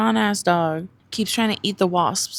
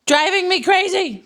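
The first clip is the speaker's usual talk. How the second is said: exasperated; 11 semitones higher, 7 dB louder than usual. Real, not acted. Exasperated at dog.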